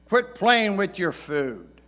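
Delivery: loud, high, slow scolding; stern dad voice. Retold or imitated. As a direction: scold like a father at dinner. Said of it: "words that many dads have said".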